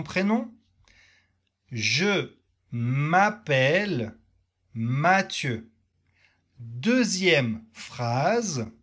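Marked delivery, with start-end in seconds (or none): 1.7-5.7 "Je m'appelle Mathieu" said slowly, with a long pause after "Je m'appelle"; slow model for repeating. Shown as a demonstration. Teacher giving students a slow model sentence to repeat. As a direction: stretch "Je m'appelle" so learners can echo it. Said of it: "Tu répètes cette phrase avec moi".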